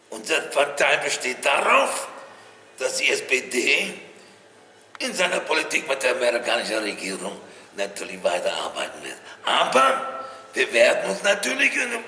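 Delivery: loud, emphatic German political oratory; put-on voice of a German statesman at the podium; Willy Brandt. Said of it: "he had a certain voice".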